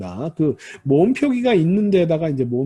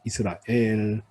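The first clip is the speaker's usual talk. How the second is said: "이스라엘" with 엘 stressed and held long; final-syllable stress; 7 semitones lower, 5 dB quieter, slower than usual. Shown as a demonstration. Teacher demonstrating late Hebrew stress, "이스라엘" stressed on the final 엘. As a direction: lean on the 엘 of "이스라엘". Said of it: "강세가 뒤에 옵니다".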